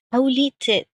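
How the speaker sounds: ordinary talk, nothing marked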